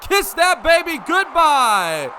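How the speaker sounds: slow, loud, high sendoff; home run catchphrase. Acted. Announcer signing off a home run. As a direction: drag out the goodbye to the ball.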